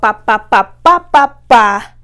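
loud popping pa pa pa; bouncing sound effect. Retold or imitated. Wig bouncing off the stall, loud pa pa pa.

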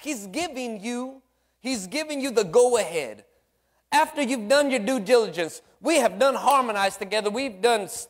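fired-up preacher; preaching with fire. Real, not acted. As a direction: build the church up. Preaching rises to full force.

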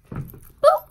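very high, loud little squeak; Betty Boop. Pretend. Vlogger showing off her outfit, playful high cartoon boop. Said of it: "Feel like little Betty Boop".

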